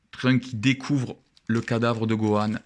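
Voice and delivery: ordinary delivery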